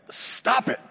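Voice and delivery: high, sudden yell of a command; acted-out high yell; mock yell. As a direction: burst out with it as the yelling therapist. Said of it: "he yells".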